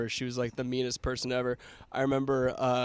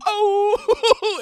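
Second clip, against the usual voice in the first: loud, high, drawn-out "ohh!"; crowd reaction "oh"; 11 dB louder than usual. Retold or imitated. Whole class reacting to a classmate's insult, loud whooping. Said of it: "And everyone's like".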